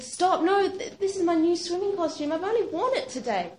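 high, loud, pleading protest; panicked protest. Retold or imitated. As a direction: beg them to stop, alarmed. Nurse cutting off her costume.

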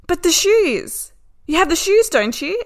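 high, loud, emphatic imitation of a driver; insistent protest. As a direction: protest as if the fix is obvious. Imitates the taxi driver's eager protest.

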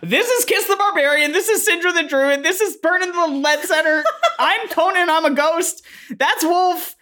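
put-on high-pitched, eager kid voice; excitable teenager. Tyler introduces the party to mom, eager and high-pitched. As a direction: rattle off introductions in a squeaky high voice.

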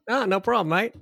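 high, loud cheery Aussie reply; breezy Australian "no worries" voice; Australian accent. Pretend. Agreeing to the murder in a cheery Australian accent. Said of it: "It's using an Australian accent".

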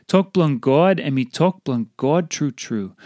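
ordinary delivery

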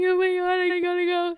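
high-pitched giddy squeal; squealing excited. Retold or imitated. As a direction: squeal it like you won. Ecstatic at boss's yes.

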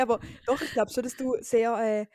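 ordinary delivery

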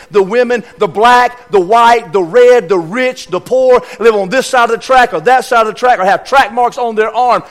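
loud, rising sermon list; preaching at full force. Acted. Preacher to his church, building to a loud climax.

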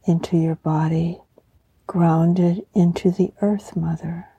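breathy guided meditation, hushed and calm; warm serene goddess voice. Acted.

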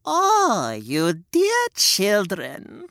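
put-on sweet old lady. Witch greets the children, sugary old lady voice. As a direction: coo at them like a kindly granny. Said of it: "said the old lady".

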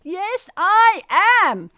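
high, loud whooping reply; gleeful yoo-hoo. Retold or imitated. Happy passenger whooping back at the crew.

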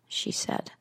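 plain reading voice

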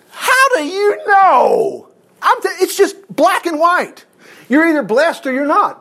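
very high, drawn-out challenge; full-force preaching. Real, not acted. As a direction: cry it out high with preaching fervor.

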